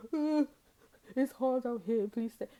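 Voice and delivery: high, loud whiny begging; mock pleading. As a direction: whine like someone begging to come back. Ex wished you'd beg back.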